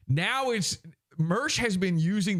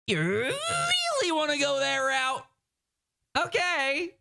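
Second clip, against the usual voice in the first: mocking taunt; 10 semitones higher than usual. Host challenges Mersh over his claim, high mocking taunt. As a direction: taunt him in a silly high voice.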